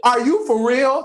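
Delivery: loud, high are you for real; stunned disbelief. Retold or imitated. Rangers watching Larigo leave, loud disbelief. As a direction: gape at the betrayal out loud.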